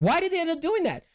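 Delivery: high, whiny disbelief; high retold complaint; indignant protest. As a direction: pitch it way up, baffled and aggrieved. Retold protest at being kicked out.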